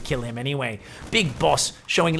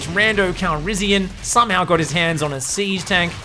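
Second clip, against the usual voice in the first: usual speaking voice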